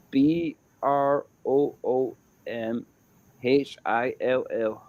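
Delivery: slow, letter-by-letter spelling. Real, not acted. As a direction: spell it out clearly, one letter at a time. Spelling the name out for listeners.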